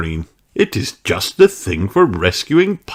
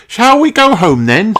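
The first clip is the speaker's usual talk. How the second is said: high, loud breezy nanny; Nanny Plum's voice; 6 dB louder than usual. Acted. Nanny Plum suggests going home.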